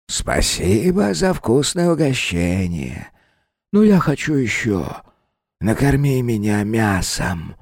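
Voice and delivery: slow, high, eerie hungry spirit. Acted. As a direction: savor each word greedily. Well spirit asks for meat.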